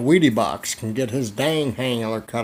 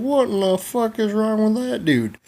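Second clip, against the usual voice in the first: high-pitched mock bafflement; high comic incredulity; acted disbelief; 10 semitones higher, 3 dB louder than usual. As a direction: blurt it in high, hammed-up disbelief. Acts out his startled reaction.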